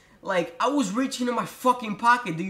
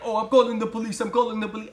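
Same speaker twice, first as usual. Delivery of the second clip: louder imitation of her threatening; mock cashier threat; 3 semitones higher, 4 dB louder, faster than usual. Cashier outside the store threatening him, imitated voice.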